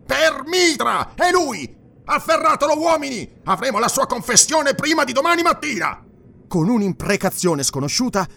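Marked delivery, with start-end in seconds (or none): 0.0-6.0 very high, loud, strained cries; frenzied high-pitched command; excited accusing shout. A narrator's reading. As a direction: shout the arrest order at the top of your range. Dionus excitedly orders the arrest.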